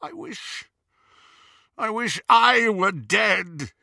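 high, slow, breaking then swelling; despairing wail. Acted. Grieving Snape to Dumbledore, slow high despairing wail. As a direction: let the wish tear out of him.

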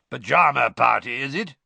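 loud growling taunt; growled. A narrator's reading. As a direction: growl a sarcastic question up the stairs. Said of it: "he growled up the stairs".

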